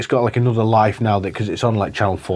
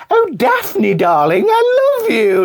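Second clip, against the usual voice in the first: high-pitched, gushing mock declaration of love; slower than usual. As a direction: imitate him swooning over her in a much higher voice.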